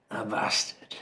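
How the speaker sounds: hushed, whispered punchline; mock-dramatic whisper. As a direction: whisper it like a grand reveal. Mock-dramatic reveal of industry name.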